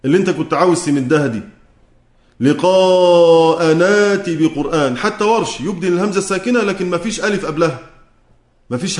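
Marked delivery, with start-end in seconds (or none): 2.4-5.0 "لقاء ناتي بقرآن" very slow, the hamza dropped; connected recitation demo. Shown as a demonstration. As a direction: recite "ناتي" joined without hamza, slowly. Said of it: "إذا وصلنا سنحذف همز الوصل". (تلاوة بطيئة موصولة "لقاء ناتي" بلا همز)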